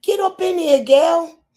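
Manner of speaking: excited call. Real, not acted. Hyping a guest joining live.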